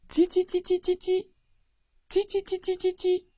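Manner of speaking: high, soft little chick chirps; soft chick cheeping. Retold or imitated. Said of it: "他的声音也是软软的". (高高的、软软的小鸡叽叽声)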